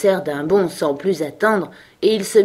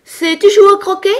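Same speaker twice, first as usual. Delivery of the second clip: very high shouted question; piercing, loud queen's yell; bawling; 14 semitones higher, 9 dB louder than usual. A narrator's reading. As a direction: shriek the question imperiously. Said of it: "brailla la reine".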